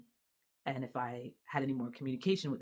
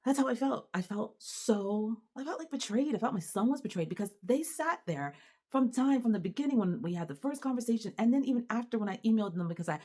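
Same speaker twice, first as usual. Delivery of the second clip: upset; 8 semitones higher, 4 dB louder than usual. Real, not acted. Angry mother recounting betrayal.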